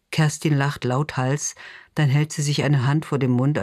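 ordinary delivery

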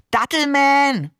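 excited call. A narrator's reading. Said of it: "rief der Seestern".

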